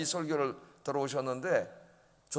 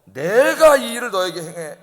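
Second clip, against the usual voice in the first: loud, slow reading of the verse; scripture reading; 2 semitones higher, 11 dB louder than usual. Real, not acted. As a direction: read the verse out strongly, slowly. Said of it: "십오 절 한번 읽어볼까요? 시작". (크고 느리게 성경 구절 낭독)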